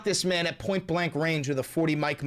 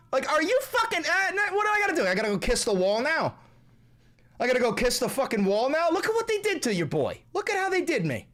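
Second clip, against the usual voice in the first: much higher, whiny mock outrage; whiny outraged caricature; 5 dB louder than usual. Streamer mocking an offended figure, whiny high outrage. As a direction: squeal like someone offended by a meme.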